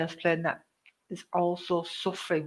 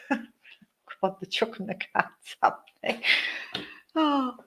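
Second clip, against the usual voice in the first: real giggle breaking through, voice jumping higher; laughing; 4 semitones higher, 4 dB louder than usual. Real, not acted. Medium laughing as spirits chuck the cards, laughing. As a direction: laugh through the words, pitch leaping up. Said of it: "I'm just laughing my socks off here".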